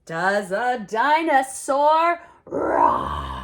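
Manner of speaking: loud, slow roaring question; playful dino roar; mock roar. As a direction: growl it like a dinosaur. Roaring like a dinosaur.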